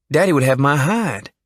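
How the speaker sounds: hint of a shy Southern young man; young Jimmy. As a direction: play the jittery small-town kid. Jimmy fearful of father.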